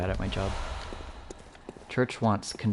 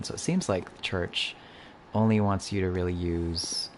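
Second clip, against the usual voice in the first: ordinary talk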